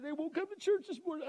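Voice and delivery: very high tearful squeak; hammed-up high whimper; mock crying. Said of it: "she was sitting there crying".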